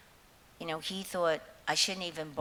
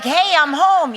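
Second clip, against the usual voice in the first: mock cheery greeting; 13 semitones higher, 18 dB louder than usual. Mock greeting on returning home.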